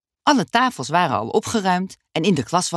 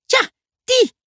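much higher, louder eager shout; excited cry; 6 dB louder than usual. Acted. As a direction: yell it out, thrilled to recognise it. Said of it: "riep Dummy". (opgewonden roep, veel hoger en luider)

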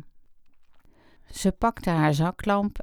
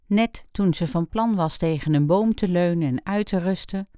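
ordinary delivery, nothing marked

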